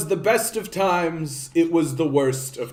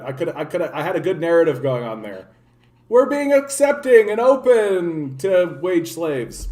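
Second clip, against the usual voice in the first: ordinary delivery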